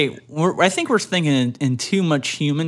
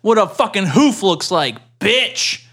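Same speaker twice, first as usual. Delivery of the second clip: higher, louder hammed-up threat; mock cow threat; 7 semitones higher, 4 dB louder than usual. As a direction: bellow a challenge to a fight.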